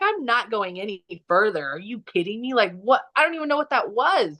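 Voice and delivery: retold mild panic, pitch climbing at the end; mildly freaked-out protest, retold; freaking out. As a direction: act out a rattled refusal. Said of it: "Like, I was freaking out".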